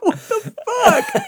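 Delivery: very high, drawn-out, laughing outburst; hammed-up squeal of alarm; mock stunned disbelief. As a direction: imitate a shocked friend, slow and high. Friend's shocked reaction.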